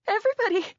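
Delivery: the throat closing on the word; high loud plea cut short; cry choked off. Acted. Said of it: "my throat choked closed".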